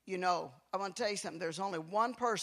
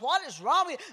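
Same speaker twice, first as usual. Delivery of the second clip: put-on higher, louder exasperated older brother; annoyed brother; 7 semitones higher, 6 dB louder, faster than usual. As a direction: snap at him like a fed-up sibling. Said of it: "my brother's like".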